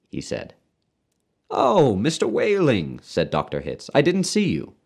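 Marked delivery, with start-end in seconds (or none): 1.5-3.0 high, drawn-out "oh" of discovery; slow, high-pitched surprise; surprised greeting. A narrator's reading. Doctor suddenly notices waiting father. 3.9-4.7 high-pitched, surprised aside; high, caught unawares; surprised apology. A narrator's reading. Doctor suddenly notices waiting father.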